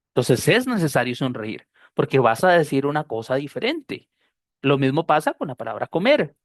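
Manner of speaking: normal talking voice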